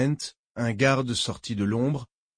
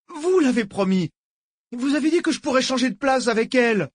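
very high, petulant whine; whining like a brat; 13 semitones higher, 4 dB louder than usual. A narrator's reading. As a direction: wail it like a spoiled child. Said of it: "aussi géniarde que celle d'un gosse capricieux". (geignard comme un gosse capricieux)

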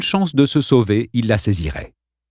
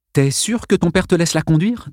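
Arlene's voice; 8 semitones higher than usual. Acted. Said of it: "demanda Arlene".

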